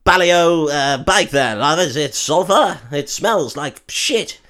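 lightly voiced posh bloke; a hint of toff disgust; mock what-what guy. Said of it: "they're like".

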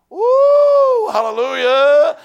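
high loud joyful whoop; loud, slow hallelujah whoop; excited shout. Real, not acted. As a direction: burst out in joy. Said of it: "I started getting excited".

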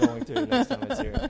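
laughing through the words. Real, not acted. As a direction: giggle it out high. Laughing about telling mom.

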